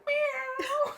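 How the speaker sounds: high squeaky cat meow; a high, playful meow like a kitten. Pretend. Joking the dough looks like a cat.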